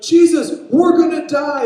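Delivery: high, loud shout for help; acted terror at full volume; panicked scream. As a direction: scream it over the storm. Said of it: "Everyone is screaming, everyone is panicking".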